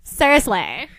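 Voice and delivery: loud tease. Acted. Nelson's teasing emphasis.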